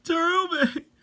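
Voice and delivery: high, drawn-out call of her name. Acted. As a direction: wail her name to the sky. Fans calling her name.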